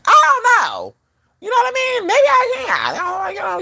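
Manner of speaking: very high squealed musing; hammed-up uncertainty.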